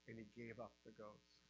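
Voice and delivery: ordinary delivery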